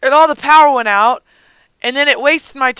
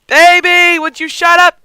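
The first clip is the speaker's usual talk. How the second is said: put-on high, loud snap at the baby; raised, exasperated holler; exasperated yell; 6 semitones higher, 5 dB louder than usual. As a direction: shout it, fed up. Yelling at crying baby.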